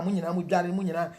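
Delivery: usual speaking voice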